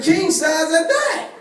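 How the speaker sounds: milk the punchline; slow brag. Retold or imitated. Telling his friends about the bed, slow triumphant brag.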